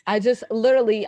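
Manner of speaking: ordinary talk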